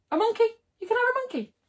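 high incredulous squeak; can't believe it; shocked at a monkey as a pet; disbelief. Real, not acted. Disbelief about pet laws.

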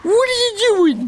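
very high, loud squeal; mock-frantic protest. Gamer protesting as the countdown runs, frantic high squeal.

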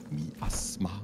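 whisper. Real, not acted. Muttering to self in game.

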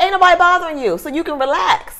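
high-pitched, dismissive reassurance; retold mean dismissal. Telling the nervous woman to relax, retold mean dismissal. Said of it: "I was being mean".

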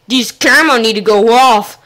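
ordinary delivery

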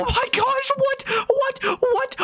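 high, squealed bursts of disbelief; stunned excitement. Real, not acted. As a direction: yelp each word, shocked by the speed. Fastest car takes off.

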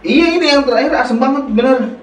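fast and excited. Real, not acted. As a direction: rush it out, worked up. Complaining about sour candy.

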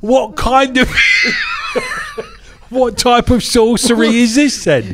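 high-pitched hammed-up Cockney, laughing; mock Cockney accent. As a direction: send up the wise men in Cockney.

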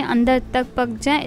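ordinary delivery

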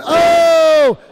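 a sudden loud, high "Oh!"; excited shout. Real, not acted. Commentator reacting to the takedown with an excited shout. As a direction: burst out at the takedown.

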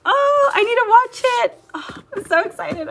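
gasp, then very high giddy exclamations; thrilled, high-pitched fangirl outburst; real excitement. Real, not acted. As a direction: squeal it, overjoyed at the sudden news. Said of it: "I got a little excited for that show".

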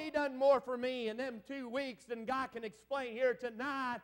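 usual speaking voice

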